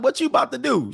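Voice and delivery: way up in pitch, disbelieving what she's doing; high incredulous question. Retold or imitated. To the date putting on a scarf, high mock disbelief. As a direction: ask in mock shock, voice shooting up. Quoting himself at the chick.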